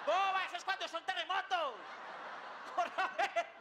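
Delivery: loud, high scolding; exasperated shout. Acted. Husband correcting his wife during a fire, in a high, loud exasperated shout. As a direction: yell it in mock panic.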